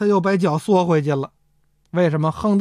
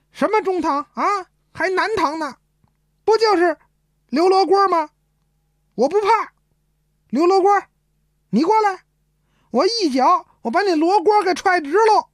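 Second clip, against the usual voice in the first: crazed cursing; 10 semitones higher than usual. Acted. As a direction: rail at him like a madman. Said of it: "他就骂上了". (疯狂地高声叫骂)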